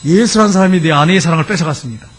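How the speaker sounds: normal talking voice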